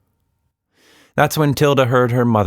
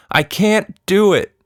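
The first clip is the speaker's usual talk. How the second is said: frustrated cry; 12 semitones higher, 3 dB louder than usual. Acted. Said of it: "she cried".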